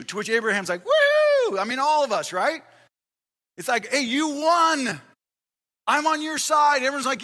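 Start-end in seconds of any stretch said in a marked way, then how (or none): 0.8-1.6 a high, loud whoop of joy; mock cheer. Abraham cheering at blessing. 3.9-6.8 lightly voiced upbeat God, higher and slow at first; cheerful God voice.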